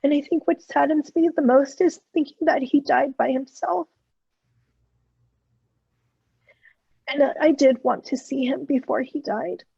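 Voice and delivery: holding back tears, long pauses to regain composure; choked up. Real, not acted. Speaker regretting a missed visit to the dying man, choked up. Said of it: "I'm getting a little choked up".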